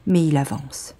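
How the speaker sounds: usual speaking voice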